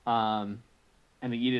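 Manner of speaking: ordinary talk, nothing marked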